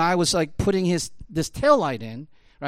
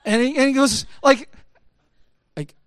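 normal talking voice

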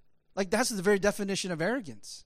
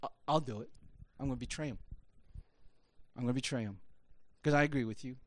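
lower, softer voice of a lightly acted betrayer; Judas; 6 semitones lower, 8 dB quieter, much faster than usual. Judas offering the chief priests betrayal, quiet and understated. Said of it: "went to the chief and said".

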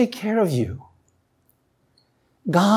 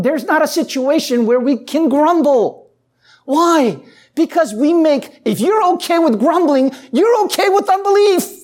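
raised, high and loud, pressing the point; fervent preaching; 8 semitones higher, 4 dB louder than usual. Acted. Pastor warning the congregation about grumbling, fervent preaching.

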